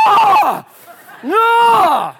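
high, loud outraged scream; a sudden outraged yell of "Ah! No!"; furious shout. Retold or imitated. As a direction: yell it out at the other driver, furious. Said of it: "I just went".

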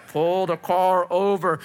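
slow megaphone-like command from the helicopter; a touch of a loudspeaker order, slow; booming voice. Retold or imitated. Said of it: "and a booming voice".

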